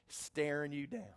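ordinary talk

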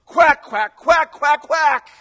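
loud, high excited quacks; roused flock quacking its amens; duck congregation quacking. Acted. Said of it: "the duck congregation would go".

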